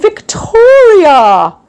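drawn-out, loud, high call of "Victoria"; a stretched, excited shout of recognition; calling out her name. Retold or imitated. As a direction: call her name out slowly and delightedly across the room. Actor greets her by name.

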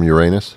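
"Uranus" said as your-AY-nus; joke pronunciation. Real, not acted. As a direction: stress the long "ay" in "Uranus". Joke question on planet name.

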